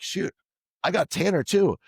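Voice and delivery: a retold gasp of surprise; surprised exclamation. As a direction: catch yourself mid-corner, amazed you passed him. Retold racing moment, surprised.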